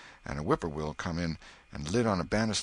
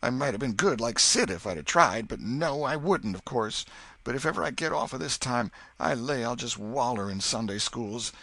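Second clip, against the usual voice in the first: much higher, louder frantic repentance; boy sure he is doomed, higher and louder; despairing boy; 7 semitones higher, 4 dB louder than usual. Acted. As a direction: wail about being a goner.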